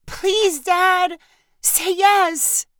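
put-on high-pitched child begging; pleading little girl Sadie. A narrator's reading. Sadie begs her dad for a wand, high pleading cry. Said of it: "cried Sadie".

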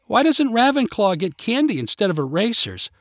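put-on high-pitched child's protest; demanding. A narrator's reading. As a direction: demand an answer like an indignant kid. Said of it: "one of the kids demanded".

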